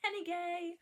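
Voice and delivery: laughing. Real, not acted. Giggling over the slip.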